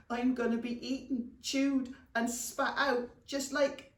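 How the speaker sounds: high, panicky goblin lament; groaning. Acted. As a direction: wail lightly, listing each awful fate. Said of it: "he groaned".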